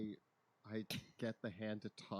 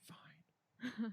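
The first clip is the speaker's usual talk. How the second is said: high breathy whisper; 13 semitones higher than usual. Acted. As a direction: concede in a tiny whisper. Grudging reply in whispered scene.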